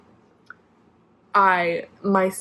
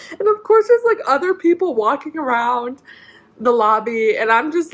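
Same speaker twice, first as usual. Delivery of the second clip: crying; 5 semitones higher, 4 dB louder than usual. Real, not acted. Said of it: "crying in this wheelchair".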